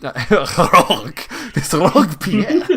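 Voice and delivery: high, loud mock-French; French accent with rolled R on "rogue"; botched French accent. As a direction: laugh through an exaggerated French accent. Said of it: "That's French".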